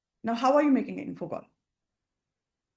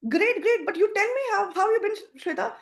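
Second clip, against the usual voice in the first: excited friend; 9 semitones higher than usual. Shown as a demonstration. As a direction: toss the question back eagerly. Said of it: "Shashi says".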